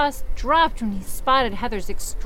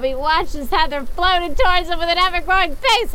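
high, louder eerie narration; ghost voice narration; 6 semitones higher, 4 dB louder than usual. Pretend. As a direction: read it in the creepy ghost voice. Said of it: "I feel like I'm her now with that voice".

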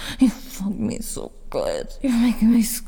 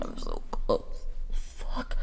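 breathless whisper; 11 semitones lower, 8 dB quieter, slower than usual. Acted. Nearing climax.

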